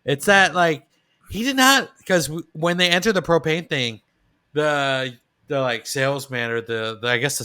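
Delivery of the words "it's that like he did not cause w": ordinary talk, nothing marked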